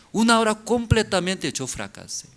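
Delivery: normal talking voice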